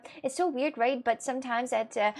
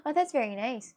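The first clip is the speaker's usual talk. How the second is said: normal talking voice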